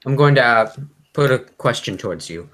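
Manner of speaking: usual speaking voice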